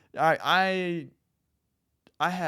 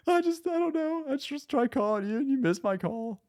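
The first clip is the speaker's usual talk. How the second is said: high, softer grandma voice; a gentle complaining grandma, pitched up; 12 semitones higher, 3 dB quieter than usual. Retold or imitated. Grandma to grandson who missed her call, high mock voice. Imitating grandma after laugh.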